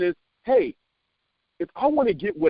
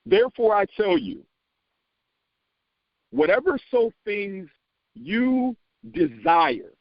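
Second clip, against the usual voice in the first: scripture reading; 6 semitones higher, slower than usual. Shown as a demonstration. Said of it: "put desire in all caps".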